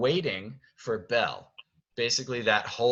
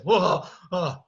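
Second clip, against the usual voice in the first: loud, high startled gasps; acted panic; 8 semitones higher, 7 dB louder than usual. Belle turns around after the jump scare, high panicked cry. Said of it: "she's just panicked almost".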